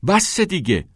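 high-pitched cry of enough; shouted plea. A narrator's reading. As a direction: shout it high, desperate to stop him. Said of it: "کریس فریاد کنان گفت". (فریادکنان با صدای زیر)

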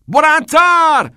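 very high, drawn-out bellow; shouted command. A narrator's reading. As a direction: goad him at full voice. Teacher keeps demanding more volume.

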